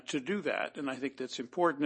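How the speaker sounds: ordinary talk, nothing marked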